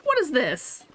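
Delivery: high bright mock excitement for kids; excited mummy voice. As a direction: sell the surprise to bored kids. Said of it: "I can, uh, go".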